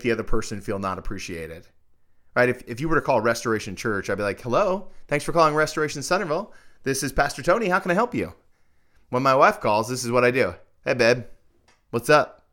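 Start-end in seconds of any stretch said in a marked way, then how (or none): 4.4-8.3 bright, fast, formal receptionist tone; polite phone greeting. Shown as a demonstration. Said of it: "Do you see the difference?". 10.8-12.5 relaxed, offhand, a bit louder; casual home greeting. Shown as a demonstration. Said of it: "Do you see the difference?".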